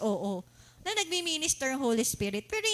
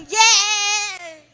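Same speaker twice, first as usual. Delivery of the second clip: mock high person; 4 semitones higher, 9 dB louder, slower than usual. Imitating someone high on drugs.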